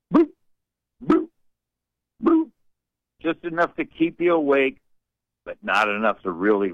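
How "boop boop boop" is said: dripping faucet boops. Retold or imitated. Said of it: "a dripping, leaking kitchen faucet".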